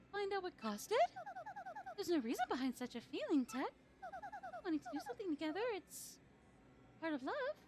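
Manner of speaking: high, soft dreamy princess; Princess Peach voice. Acted. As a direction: sigh it out like a longing princess. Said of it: "my Peach voice".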